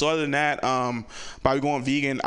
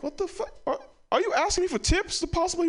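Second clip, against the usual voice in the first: much higher, stunned squeal; shocked disbelief. Retold or imitated. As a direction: caught completely off guard, voice jumping high. Shocked at cousin going vegan.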